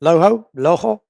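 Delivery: Loho said French-style as "lo-ho", the r turned into a breathy h; French accent. Shown as a demonstration. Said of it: "I have to say it in French accent".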